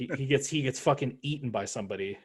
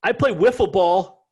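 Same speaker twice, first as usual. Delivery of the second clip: mock Boston accent; 6 semitones higher, 7 dB louder than usual. Mocking Boston recruits.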